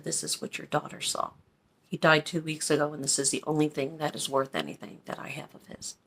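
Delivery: very soft, low, half-whispered; hushed confiding. Retold or imitated. Widow showing the dad her late husband's coins, hushed. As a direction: confide it quietly, letting the voice drop away.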